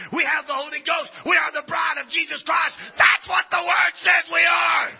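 preacher proclaiming who the church is; full-force preaching. Acted. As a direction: declare it to the church.

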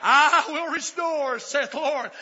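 loud, high, drawn-out scripture cry; shouted proclamation. Real, not acted. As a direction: proclaim it at full preaching force. Proclaims scripture at full force.

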